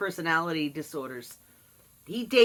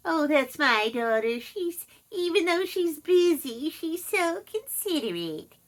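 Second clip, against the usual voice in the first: high, sweet put-on mother voice; a higher, sugary mock of my mother; mother showing off her daughter to the aides, pitched up; imitating mother; 8 semitones higher than usual. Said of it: "mother bragging to aides".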